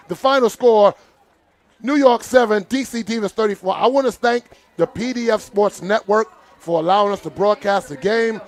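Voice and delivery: normal talking voice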